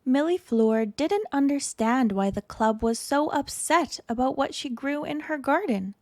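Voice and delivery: plain reading voice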